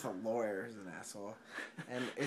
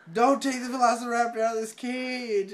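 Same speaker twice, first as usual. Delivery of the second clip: loud, high acted yell; shouted warning; 9 semitones higher, 11 dB louder than usual. Hunter warning about the velociraptor cage, urgent shout. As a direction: bellow it as an alarmed order.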